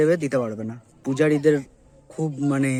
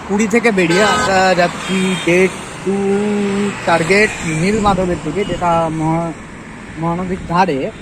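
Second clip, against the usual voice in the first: loud and raised over wind noise; shouting over wind; 4 semitones higher, 5 dB louder than usual. Real, not acted. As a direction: push the voice over the road noise. Talking to camera while riding.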